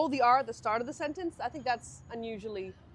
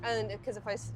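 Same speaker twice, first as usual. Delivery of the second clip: usual speaking voice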